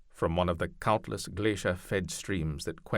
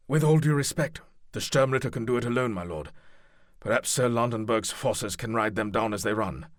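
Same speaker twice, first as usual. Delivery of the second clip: higher, louder deferential captain; knight captain voice; 4 semitones higher, 5 dB louder than usual. Acted. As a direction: answer the lord crisply, raised. Franco addressing the duke.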